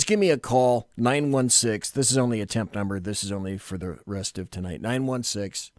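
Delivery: ordinary delivery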